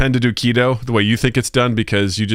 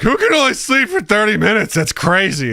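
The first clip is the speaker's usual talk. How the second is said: mock disbelief; 11 semitones higher than usual. Mocking his old disbelief.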